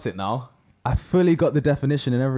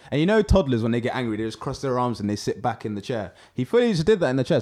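normal talking voice

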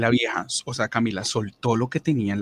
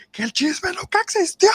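much higher, louder insistence; exasperated yell; 5 dB louder than usual. Retold or imitated. Arguing with the crying coworker, exasperated high yell. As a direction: yell "existió" as if you've said it ten times. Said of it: "nos empezamos a gritar".

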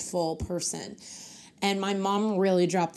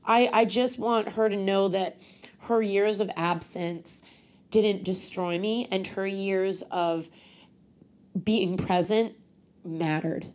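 usual speaking voice

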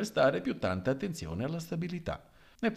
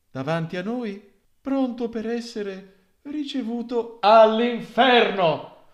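high, measured priest's plea that grows louder at the end; a solemn appeal to the mourners; priest's sermon voice; 9 semitones higher, 4 dB louder than usual. Acted. Priest urging the congregation to restraint, high and solemn.

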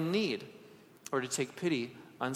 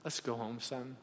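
much softer, lower and tender; quiet forgiveness; gentle; 5 semitones lower, 12 dB quieter than usual. Retold or imitated. Father finding his hiding son, soft and tender. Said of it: "and he just said".

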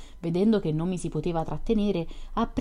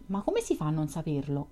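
ordinary delivery, nothing marked

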